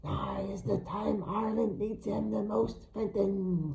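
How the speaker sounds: slow raspy hag voice; hammed-up cinematic crone; raspy and low. A narrator's reading. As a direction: put it on like a film witch. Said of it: "her voice raspy and low".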